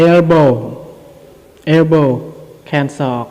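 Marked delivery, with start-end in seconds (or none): 0.1-2.1 louder, clearly enunciated "elbow"; clear pronunciation demo. Shown as a demonstration. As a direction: model "elbow" clearly for learners, falling at the end. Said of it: "จะมาออกเสียง ที่ชัดเจน". (ອອກສຽງ "elbow" ໃຫ້ຊັດເຈນ)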